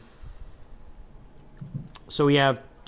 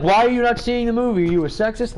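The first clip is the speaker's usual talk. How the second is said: higher-pitched imitation of indignant critics; mock accusing; 7 semitones higher than usual. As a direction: mimic people demanding an explanation, pitched up. Mimics people challenging his choice.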